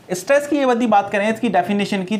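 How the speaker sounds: ordinary delivery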